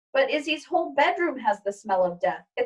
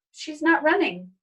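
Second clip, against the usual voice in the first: note it with surprise; child's voice; 6 semitones higher than usual. Acted. Izzy watching the hamper, higher child voice.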